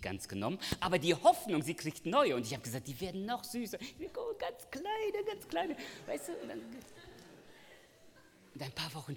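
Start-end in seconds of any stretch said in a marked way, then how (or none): 2.9-6.6 put-on cooing baby talk. Father cooing to his daughter about baby rabbits, high and cute. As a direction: coo over tiny bunnies.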